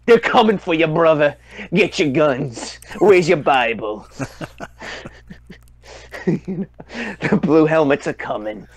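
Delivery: mock paranoid radio host, slow and hammed-up; Alex Jones style rant. Joking about Alex Jones, mock conspiracy rant. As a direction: ham up a conspiracy broadcaster warning of invasion. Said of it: "tinfoil hat on and watching Alex Jones".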